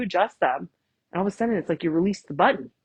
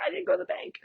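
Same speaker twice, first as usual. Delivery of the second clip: much higher, a hint of comic alarm; mock panic; 12 semitones higher, 4 dB quieter, faster than usual. Realizing a forgotten bank errand: light mock panic. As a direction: squeak it out in half-joking dismay. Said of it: "I was like".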